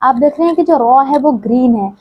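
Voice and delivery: ordinary delivery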